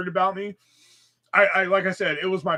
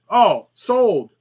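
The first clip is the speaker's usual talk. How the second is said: louder, higher call-out to claim it; mock eager buyer; 5 semitones higher, 8 dB louder than usual. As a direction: blurt it out like a quick bid. Mock re-enactment of claiming a piece.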